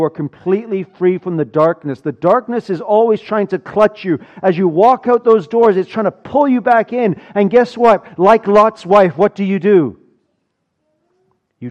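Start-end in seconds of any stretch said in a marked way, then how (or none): 2.0-10.0 higher and louder, building with urgency; rising sermon intensity; preaching at full force. Real, not acted. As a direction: drive the warning home to the congregation. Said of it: "preaching builds to full force".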